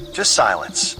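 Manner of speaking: ordinary delivery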